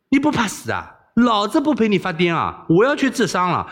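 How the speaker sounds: high, indignant refusal; exasperated. Acted. Wei Xiaobao refusing the princess's mad game, exasperated. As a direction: scold her in a high, fed-up voice.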